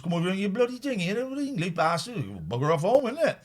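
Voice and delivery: lightly hammed Cornish rant; Cornish yokel accent. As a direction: chase the English off in Cornish. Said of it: "They talk like that".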